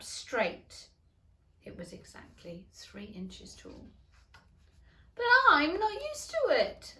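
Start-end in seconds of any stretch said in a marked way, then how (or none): 5.2-7.0 put-on high, loud girl's wail; plaintive Alice. A narrator's reading. Alice protesting to the caterpillar, high and loud. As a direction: voice Alice much higher. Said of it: "said Alice".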